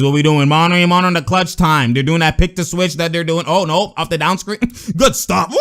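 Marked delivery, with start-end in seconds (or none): 5.5-5.6 drawn-out cheering woo; excited whoop. Real, not acted. Sports watcher cheers a good stop, excited whoop. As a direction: let out a whoop.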